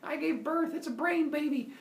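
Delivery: high-pitched gleeful announcing; so excited. Retold or imitated. Zeus announcing his brain birth, high excited voice. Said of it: "Zeus is so excited".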